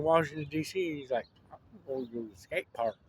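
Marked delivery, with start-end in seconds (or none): 1.5-2.9 mumbled James voice. Retold or imitated. James voiced in retold story.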